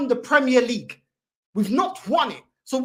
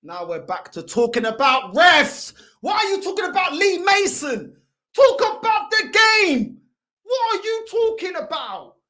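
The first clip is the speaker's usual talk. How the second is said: exasperated shouting; 3 semitones higher, 4 dB louder than usual. Real, not acted. Fan demanding match talk instead of refs, exasperated. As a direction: build to a loud, exasperated demand.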